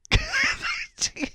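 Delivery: a high, squeaky giggle. Real, not acted. Host laughing at the caller's technical trouble, giggling. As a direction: let a real giggle slip out. Embarrassed caller cannot get through.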